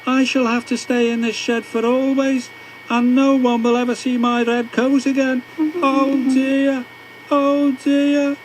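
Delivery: high, sorry-for-himself whine, building to tears; woeful engine lament. A narrator's reading. James shut in the shed, high and tearful. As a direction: moan it like a sulking engine near tears. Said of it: "James began to cry".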